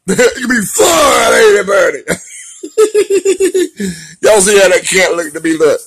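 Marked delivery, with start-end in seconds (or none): 0.1-2.0 loud, slow call to the cat; booming teasing at the cat Bernie; playful shout. Real, not acted. Shouting playfully at his cat Bernie, drawn out. 2.1-4.2 big high burst of laughter; howling laugh, loud and high. Real, not acted. Laughing loudly at his cat, big high cackle.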